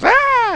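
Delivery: high startled shriek, mock; startled scream. Girl spotting burglar at door lets out high shriek. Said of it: "and she goes".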